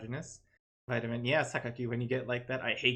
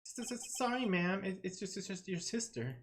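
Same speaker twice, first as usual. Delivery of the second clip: high-pitched stammering apology; hammed-up flustered underling; nervous guard; 7 semitones higher than usual. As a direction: stutter it out like a cowed guard. Guard apologising to his boss.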